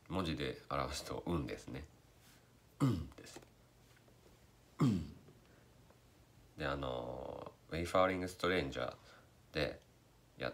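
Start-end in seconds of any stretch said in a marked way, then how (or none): 2.8-5.1 humming "うん" bass drum hit; voiced beatbox kick. Shown as a demonstration. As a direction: mix voice into the kick "うん". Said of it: "声を混ぜると言いますか".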